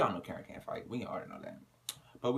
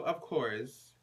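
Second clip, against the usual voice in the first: ordinary talk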